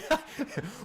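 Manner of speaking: breathy high-pitched giggle; helpless real giggle. Real, not acted. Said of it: "Sorry, I can't help it".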